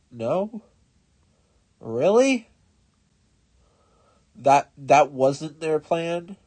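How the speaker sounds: real disbelief at the enemy's move; surprised disbelief. Real, not acted. Gamer baffled by purple's strange plan, surprised.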